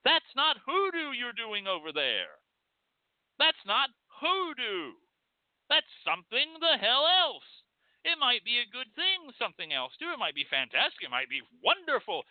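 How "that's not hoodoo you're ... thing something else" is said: voice of sanity. Pretend. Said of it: "voice of sanity out in the woods that says".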